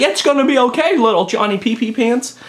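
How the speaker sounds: high, mock-soothing baby talk. Vlogger mocking coddling with baby talk, high. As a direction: coo at a child mockingly. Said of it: "It's like".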